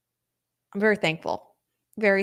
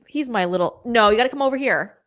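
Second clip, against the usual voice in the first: ordinary talk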